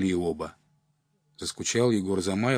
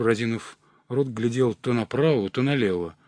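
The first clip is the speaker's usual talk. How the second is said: plain reading voice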